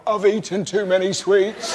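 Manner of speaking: slow, pitiful whimper of an admission; mock-pitiful, slow and sorry; pathetic confession. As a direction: sound truly pathetic to a kind stranger. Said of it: "truly pathetic".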